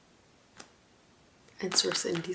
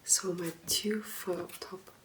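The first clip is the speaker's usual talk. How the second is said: gentle ASMR soft-spoken voice with long pauses; soft talking; 4 dB quieter than usual. Acted. ASMR host introducing favorite books to viewers, soft talking. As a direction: talk softly and unhurriedly to the listener.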